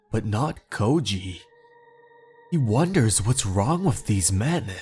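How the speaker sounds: ordinary delivery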